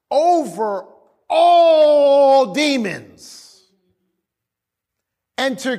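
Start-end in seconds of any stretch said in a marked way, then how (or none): 1.3-3.4 "all" held very long, high and loud; preacher's drawn-out, ringing "all"; stress on "all". Real, not acted. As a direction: belt out "all", stretching it before "demons". Preacher hammers the scripture's key word.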